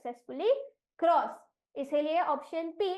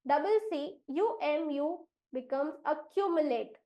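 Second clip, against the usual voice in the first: normal talking voice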